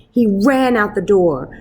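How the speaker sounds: ordinary delivery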